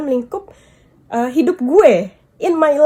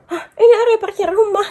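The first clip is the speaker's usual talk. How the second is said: retold exasperated reply; 7 semitones higher, faster than usual. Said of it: "gitu kan".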